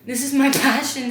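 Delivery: loud, breaking into a laugh midway. Real, not acted. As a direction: laugh through the word passion. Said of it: "I feel so fucking cool".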